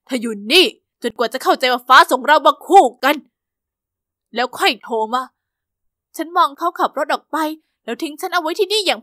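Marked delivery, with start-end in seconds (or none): 0.1-5.2 put-on cold boyfriend. Charlie abandons her, cold.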